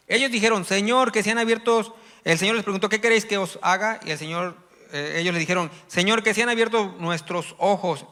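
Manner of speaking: loud, driving sermon voice; preaching at full force. Acted. Preacher retelling the healing of the blind to the church. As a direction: preach it to the congregation with fire.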